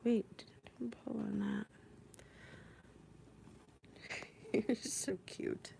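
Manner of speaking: faint whisper with little laughs. Real, not acted. Cat tugging the cord, whispering and giggling.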